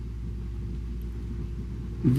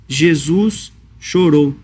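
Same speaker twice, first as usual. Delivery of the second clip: solemn slow; much slower than usual. Acted. Solemn pause on key verse.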